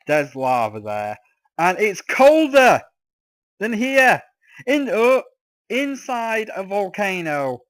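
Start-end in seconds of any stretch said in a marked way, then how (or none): 1.6-7.6 louder, higher outraged cry; exasperated outburst. Real, not acted. Telling viewers a volcano is colder, an outraged loud outburst. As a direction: blurt out how absurd the heat is.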